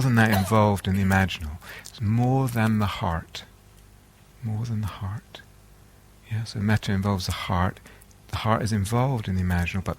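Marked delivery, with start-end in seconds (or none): 4.4-5.4 soft, near-whispered echo; hushed repeat. Real, not acted. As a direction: let the point sink in, very softly.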